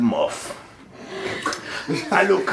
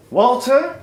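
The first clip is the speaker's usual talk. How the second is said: higher, louder call; 4 semitones higher, 4 dB louder than usual. Acted. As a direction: call the butler in a high, sharp voice. Integra calls the butler.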